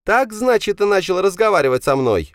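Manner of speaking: loud, very high-pitched angry roar. A narrator's reading. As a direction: roar back at father, pitch way up. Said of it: "взревел Павел". (взревел высоким злым голосом)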